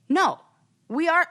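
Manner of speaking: high-pitched defiant comeback; defiant retort. Acted. The comeback she wishes she had made, defiant. Acts out talking back, regretted silence.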